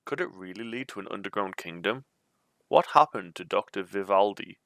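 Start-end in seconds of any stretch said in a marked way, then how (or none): none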